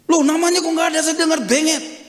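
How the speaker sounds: high, loud imitation of an angry elder; acted angry complaint. Grandparent storming into the pastor's office, acted anger. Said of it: "marah-marah".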